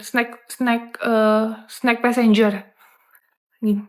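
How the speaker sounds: ordinary talk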